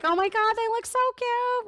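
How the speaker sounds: squealing high, gushing over cute babies; gushing excited. Retold or imitated. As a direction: gush like a thrilled new mom, pitch soaring. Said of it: "I'm all excited".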